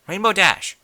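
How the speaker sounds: loud, high call of her name; Rarity calling sharply to get Rainbow's attention. A narrator's reading. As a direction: snap her out of her daze with a shout. Rarity calls inattentive Rainbow.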